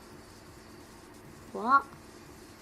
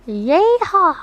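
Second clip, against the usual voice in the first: very loud, high whooping holler; cowboy yell; 8 semitones higher, 18 dB louder than usual. Pretend. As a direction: whoop it out right into the mic. Tests yelling into the mic.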